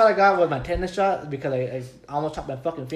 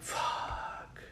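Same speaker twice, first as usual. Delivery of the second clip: whispered curse; 15 dB quieter than usual. Retold or imitated. Quiet dismayed curse in story.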